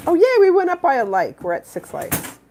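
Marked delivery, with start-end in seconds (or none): 0.1-1.4 high excited cheer. Real, not acted. Cheering at new like.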